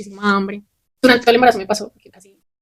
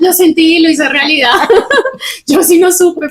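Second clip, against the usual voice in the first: spoken through laughter, louder and higher; laughing; 7 semitones higher, 6 dB louder, slower than usual. Real, not acted. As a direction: blurt it out while cracking up. Laughing over a shared story. (riendo a carcajadas)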